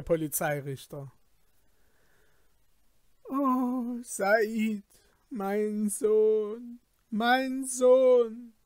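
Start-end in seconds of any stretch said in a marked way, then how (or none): 3.2-8.5 weeping father. Acted. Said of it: "rief der unglückliche Vater und weinte".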